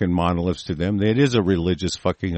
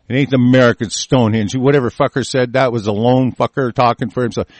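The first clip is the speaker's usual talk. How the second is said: normal talking voice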